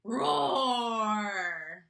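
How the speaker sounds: loud drawn-out lion roar; big roar. A narrator's reading. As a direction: roar like a proud lion king. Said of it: "by how loud he could roar".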